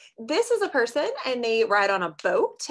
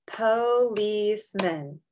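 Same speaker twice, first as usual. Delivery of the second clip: slow, syllable by syllable; po-lice-man, one beat per part; clapped syllables; much slower than usual. Shown as a demonstration. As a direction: clap each part out slowly. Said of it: "So I gotta clap out the syllables".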